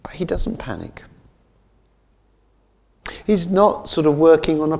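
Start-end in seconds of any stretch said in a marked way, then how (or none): 0.0-1.0 hushed whisper. Acted. Preacher to congregation, whispered for dramatic weight. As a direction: drop to a whisper for effect. Preacher drops to hush for emphasis.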